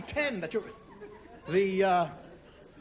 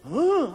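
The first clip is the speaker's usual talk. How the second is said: loud, high gasp of dismay; shocked "oh"; 6 semitones higher, 9 dB louder than usual. Acted. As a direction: let out a big mock-horrified oh. Family reacts in shock together.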